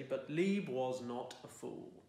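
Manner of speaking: ordinary delivery, nothing marked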